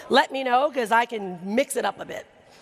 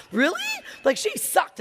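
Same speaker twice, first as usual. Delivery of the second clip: mock outrage; 9 semitones higher than usual. Real, not acted. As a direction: exclaim it in disbelief. Outraged aside.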